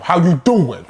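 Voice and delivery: New York Italian. Acted. Co-host introducing himself, in New York Italian accent. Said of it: "it was like New York Italian".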